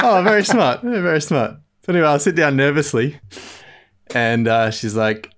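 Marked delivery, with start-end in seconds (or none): 0.0-1.6 a mock "very smart" that breaks into laughter; laughing sarcasm. Patient laughing at the nurse who passed him off. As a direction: laugh through a teasing "very smart".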